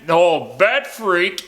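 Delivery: high, slow, drawn-out taunt; hammed-up high taunt; mock defiant scream. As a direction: yell it like a hurt thug who still mouths off. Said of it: "screaming things like".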